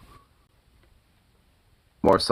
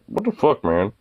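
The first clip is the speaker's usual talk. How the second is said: much higher, offended dog voice; voicing the dog; 5 semitones higher than usual. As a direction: squeak out the dog's outrage. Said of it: "or just like".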